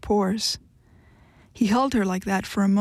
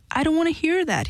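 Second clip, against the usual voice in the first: high, sharp shout; 8 semitones higher than usual. A narrator's reading. Husband covering his ears, shouting at his wife to stop. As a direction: shout to drown out what she just said. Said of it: "he shouted".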